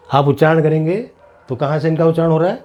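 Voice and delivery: normal talking voice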